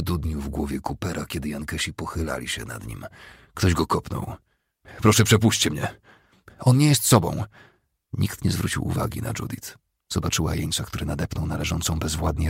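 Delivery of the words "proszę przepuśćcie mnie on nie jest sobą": high, raised-voice begging; distressed calling out; desperate plea. Acted. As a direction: plead urgently to get through. Judith begs prisoners to let her through.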